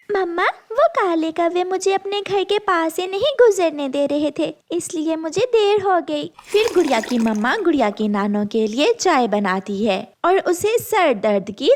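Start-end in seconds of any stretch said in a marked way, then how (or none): 0.1-6.3 put-on high childlike pleading voice; a small girl asking to pass, higher; little girl voice. (ऊँची आवाज़ में छोटी बच्ची)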